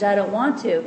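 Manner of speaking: ordinary talk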